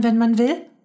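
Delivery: put-on high child's voice, rising at the end; girl's incredulous question; amazed question. A narrator's reading. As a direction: ask it high and astonished. Mia asks, man confirms.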